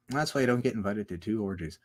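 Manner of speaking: low and understated; toned down. Real, not acted. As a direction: drop the voice and say it quietly. Same line repeated, toned down.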